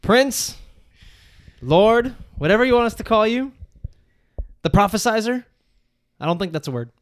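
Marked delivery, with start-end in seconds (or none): 0.0-5.4 mock grand address. Mock-grand title for friend.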